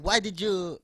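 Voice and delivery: loud, exasperated Filipino dad; mock angry dad lecture; dad's scolding. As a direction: scold like a fed-up father. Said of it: "he was like".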